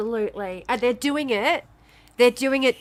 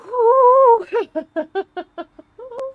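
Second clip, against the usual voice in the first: high, louder half-laugh, half-cry of rage; high angry laugh-cry; 12 semitones higher, 6 dB louder than usual. Real, not acted. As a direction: crack into a furious wail. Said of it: "Sorry, I am so fucking angry".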